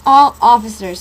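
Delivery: loud megaphone command; police megaphone. Acted. Police call out over the loudspeaker, loud. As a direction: bark it through a loudspeaker. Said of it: "a single command echoed".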